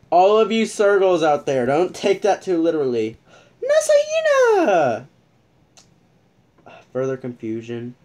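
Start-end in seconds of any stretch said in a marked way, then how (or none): 3.6-5.1 very high drawn-out call of the name; excited squeal. Real, not acted. Spotting an idol on screen, squealing. Spots a favourite furry.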